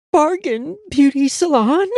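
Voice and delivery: high, whimpered rising question; whimpering. A narrator's reading. Ashamed client naming the cheap salon, high whimper. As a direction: whimper it out timidly. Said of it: "she whimpered".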